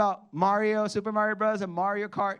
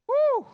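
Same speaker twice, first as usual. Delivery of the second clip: sudden high, loud joyful whoop; excited whoop; 5 dB louder than usual. Real, not acted. Pastor whooping with joy at the verse. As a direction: let out a real cry of delight. Preacher exults after scripture.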